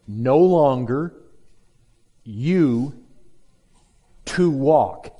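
"No longer ... you ... to walk" in separate chunks with long pauses; literal word order. Shown as a demonstration. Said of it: "it literally reads this way".

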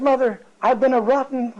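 a son begging forgiveness, voice lifted high; imitating a remorseful son's plea; tearful plea. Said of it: "he broke down weeping".

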